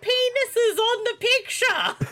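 high-pitched disbelief; incredulous. Real, not acted. Co-host baffled by what the movie shows, high incredulous squeal.

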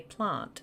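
"plant" as "plahnt"; broad ah vowel in "plant"; long-A plant. Shown as a demonstration. Insisting ours sounds normal, "plant" as "plahnt". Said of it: "We say plant".

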